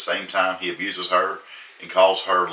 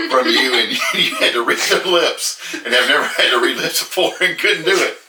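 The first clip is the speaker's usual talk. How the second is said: stifled giggles; 6 semitones higher, 5 dB louder than usual. Real, not acted. Laughing through a book review.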